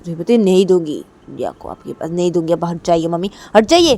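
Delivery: loud refusal. Acted. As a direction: refuse and shout her away. Refusing and shouting. (चिल्लाकर इनकार)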